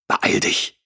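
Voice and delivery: urgent hissed whisper; a sharp, voiceless hiss. A narrator's reading. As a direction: hiss it at her, rushed and breathless. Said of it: "zischte er sie an".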